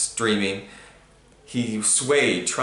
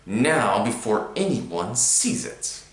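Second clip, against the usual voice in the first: menacing snarl; much slower than usual. A narrator's reading. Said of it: "Uncle Vernon snarled".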